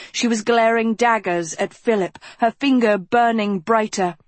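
usual speaking voice

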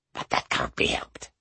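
breathy whispered aside. A narrator's reading. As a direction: drop to a whisper. Whispered aside of plotting witch.

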